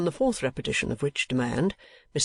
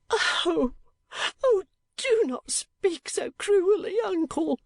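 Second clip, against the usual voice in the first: much higher, slow, tearful wife's voice; sobbing plea; slower than usual. A narrator's reading. Wife begs her rich uncle to stay, sobbing. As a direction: plead through sobs. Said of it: "sobbed Missus Kenwigs".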